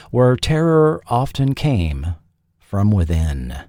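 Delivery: ordinary delivery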